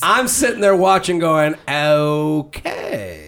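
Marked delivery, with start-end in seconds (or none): 1.7-3.3 drawn-out, non-committal okay; unimpressed okay. Retold or imitated. Host watching sisters' Instagram posts, reacting with a drawn-out, even-keeled okay. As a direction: stretch it out, keeping it even.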